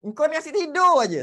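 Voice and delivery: a hint of an annoyed parent; lightly played nagging parent; mock scolding parent. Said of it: "Kenapa dia kata sedia".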